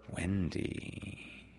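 soft, drawn-out coaxing; sly. A narrator's reading. Peter working on Wendy, soft and sly. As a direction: coax softly on the name. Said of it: "the sly one".

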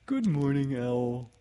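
high-pitched, eager storybook greeting; Pooh. Acted. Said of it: "he said".

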